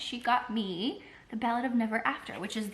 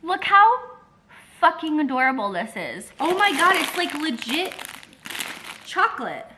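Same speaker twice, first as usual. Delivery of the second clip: louder, higher real gushing; gushing delight; 6 semitones higher, 8 dB louder than usual. Real, not acted. Showing viewers the gift, loud gushing delight. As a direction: rave about how cute it is.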